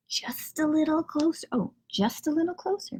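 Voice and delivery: whispered inner thought; thinking to himself. Acted. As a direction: whisper it like a secret plan. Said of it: "Pete thinks".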